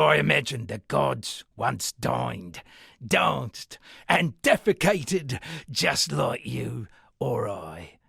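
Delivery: usual speaking voice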